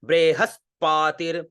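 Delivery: बृहस्पतिर said slowly with weight on स्प; stress on double consonant. Shown as a demonstration. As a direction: lean on the double letter in बृहस्पतिर. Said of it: "उस शब्द पे जोर देना है".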